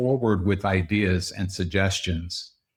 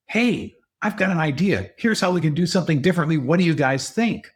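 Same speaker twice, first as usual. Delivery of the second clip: higher-pitched, quickened pitch of a new idea; excited boss; 7 semitones higher than usual. Acted. Boss bursting in on the team with an idea, excited. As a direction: burst in eager to share your brainwave. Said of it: "comes in really excited and says".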